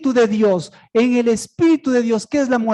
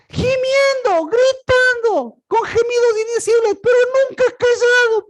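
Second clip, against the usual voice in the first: wailing lament; 8 semitones higher than usual. Acted. Acts out groaning spirit in Hades.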